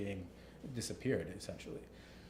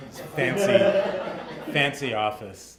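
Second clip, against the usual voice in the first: laughing; 7 dB louder, much slower than usual. Real, not acted. Laughing at the fancy office.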